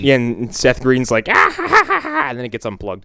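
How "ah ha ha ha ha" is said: high, loud hammed-up cackle; mock maniacal laugh. Seth Green's character revving the slicer: high loud mock cackle.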